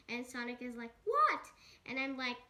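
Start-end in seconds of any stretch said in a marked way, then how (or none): 1.0-1.4 high, loud yelp of surprise; sudden high "what?!" dropping at the end; startled mock yelp. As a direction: blurt it out, shocked, pitch jumping up. Sonic's startled reply in retold quarrel.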